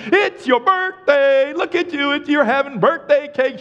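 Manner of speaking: high, loud, slow sing-song shout; cheery greeting. Retold or imitated. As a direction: burst in celebrating her birthday. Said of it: "It's like".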